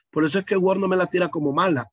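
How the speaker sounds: usual speaking voice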